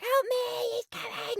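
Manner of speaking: high-pitched imitation of a frightened kid; mock panicked plea. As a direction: cry for help in a put-on squeal. Locked-up kid begging for help.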